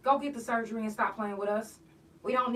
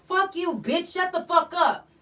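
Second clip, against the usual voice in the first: much higher, louder mock yell; imitated thug yell; 7 semitones higher, 6 dB louder than usual. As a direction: bark it like a boy showing off to a woman. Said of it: "talk to a woman like".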